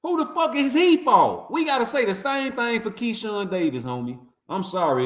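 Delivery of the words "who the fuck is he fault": ordinary delivery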